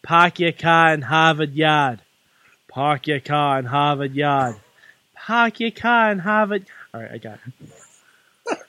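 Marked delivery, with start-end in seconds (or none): 0.0-6.7 slow, broad Boston accent warm-up. Shown as a demonstration. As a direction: drop the R's, Boston style, stretched out slowly. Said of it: "get my levels for Boston".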